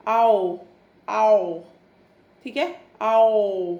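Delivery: आओ said slow and open, the mouth rounding wide; drawn-out ow sound. Shown as a demonstration. Teacher showing children the ow sound, mouth open wide. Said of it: "Look at my mouth".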